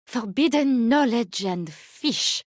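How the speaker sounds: usual speaking voice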